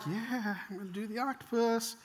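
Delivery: put-on uneasy child in line, voice up and small; hesitant, high-pitched kid; wavering child. Child losing nerve in line.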